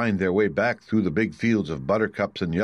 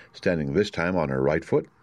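ordinary delivery

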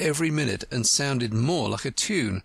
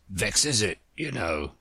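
put-on old frog; 3 semitones lower than usual. Frog speaking.

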